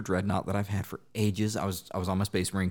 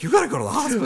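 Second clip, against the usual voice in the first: mock urgent wife; 8 dB louder, much faster than usual. Wife voiced urgently, laughing.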